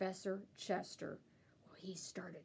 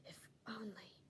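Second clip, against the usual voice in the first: muttered; 9 semitones higher than usual. A narrator's reading. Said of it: "he muttered".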